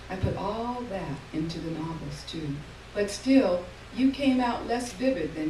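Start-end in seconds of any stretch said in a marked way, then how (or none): none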